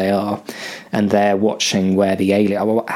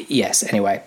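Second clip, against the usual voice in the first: ordinary talk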